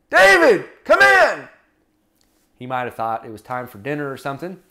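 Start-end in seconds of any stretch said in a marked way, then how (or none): 0.1-1.5 high, loud call with drawn-out words; hollering out. Acted. Father calling his son in from outside, hollering. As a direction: holler for someone to come in. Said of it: "Jesse hollers out".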